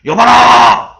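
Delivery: king calling out loudly to his people; loud royal call. Retold or imitated. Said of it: "큰 소리로".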